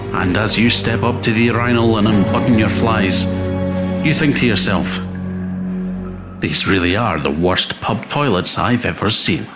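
ordinary delivery, nothing marked

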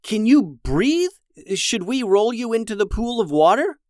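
high-pitched, urgent checking; concerned questioning. Acted. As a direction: ask with alarm for your stranded brother. Brother checking on stranded shark.

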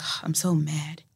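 deadpan mad. Retold or imitated. Director imitated saying he's mad, quietly. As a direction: say you're mad with no heat at all. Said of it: "he wasn't yelling".

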